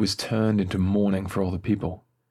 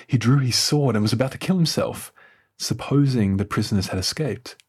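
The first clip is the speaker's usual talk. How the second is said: plain reading voice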